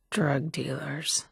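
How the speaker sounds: slow scornful whisper; whispered jab. Acted. Survivor sneering at drug dealers, whispered. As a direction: breathe it out under your breath, unimpressed. Muttered aside about dealers.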